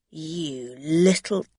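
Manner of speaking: slow, angry growl of an insult. Acted. Drunk man turns angrily on Rosa, slowly. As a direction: spit it out slowly in anger. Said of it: "he said angrily".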